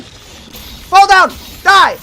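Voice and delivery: loud shouted command at the demon; yelling at boss. Real, not acted. Player shouting at the Cyber Demon to die, loud. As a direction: roar it at the monster to fall.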